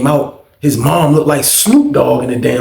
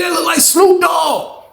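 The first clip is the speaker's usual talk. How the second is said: high, drawn-out cry of disbelief; incredulous exclamation; 14 semitones higher, slower than usual. Real, not acted. Storyteller swearing the portrait looks like [name], incredulous. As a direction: let the pitch leap up and stretch it.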